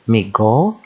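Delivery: Mi go run together as one word; joined syllables. Shown as a demonstration. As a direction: join Mi and go without a gap. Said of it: "two syllable words".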